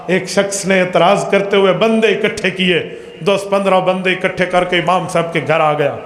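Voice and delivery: ordinary delivery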